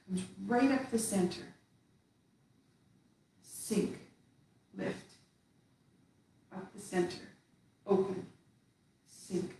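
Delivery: slow, soft movement cues with long pauses. Shown as a demonstration. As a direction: call each move slowly, letting it settle. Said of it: "so now we did that slowly".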